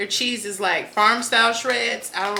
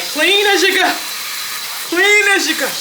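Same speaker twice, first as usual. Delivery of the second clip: high, loud bossy mother voice; mama's voice; 10 semitones higher, 7 dB louder than usual. Retold or imitated. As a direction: boss yourself around like your mom would. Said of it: "that's the Sherry. That's my mama name".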